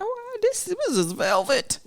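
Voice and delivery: squeaky-high mock show-off, slow on velvet; high, slow mock pride in the velvet; mock fancy partner. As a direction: play the proud partner showing off sheets. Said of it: "they might try something like".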